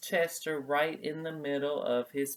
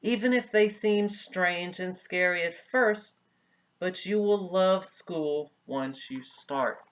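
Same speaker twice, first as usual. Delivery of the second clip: higher, louder, gentle motherly comfort; 6 semitones higher, 6 dB louder than usual. Acted. Mother raccoon comforts scared son before school, gentle and high. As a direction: soothe him like a caring mother raccoon. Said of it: "she told him gently".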